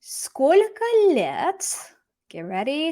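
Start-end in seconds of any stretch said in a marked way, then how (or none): 0.0-1.6 Сколько лет pitched high and slow; reading the Russian. Shown as a demonstration. Teacher beginning the answer, slow raised voice.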